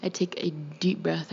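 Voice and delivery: plain reading voice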